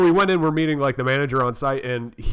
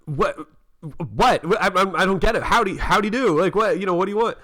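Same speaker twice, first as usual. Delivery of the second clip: confused; 6 semitones higher than usual. Retold or imitated. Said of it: "I'm like".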